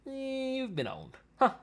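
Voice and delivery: mock gloat. Mocking the video's claim.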